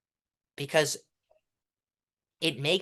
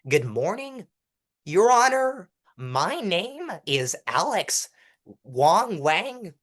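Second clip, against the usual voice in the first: "Your Honor" pitched much higher and louder, slow and lifting; going up at everything; 6 semitones higher, 4 dB louder, much slower than usual. Shown as a demonstration. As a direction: sing each phrase upward like a stiff speech opener. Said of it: "everyone goes up".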